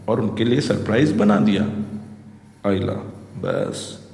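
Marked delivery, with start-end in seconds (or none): 2.7-4.1 shocked whisper. Acted.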